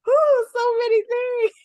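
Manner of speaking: high, tearful gasp of feeling; choked up with feeling, voice rising high; near tears. Real, not acted. Emotional friend, near tears.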